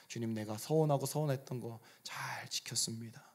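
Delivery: quiet confession. Acted. Paul whispering his faithful vow to the Lord, hushed. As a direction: hush it like a private prayer.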